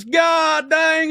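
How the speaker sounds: high-pitched, mock-amazed exclamation; retold excited outburst. Comic retelling of a man seeing that face on TV, excited outburst.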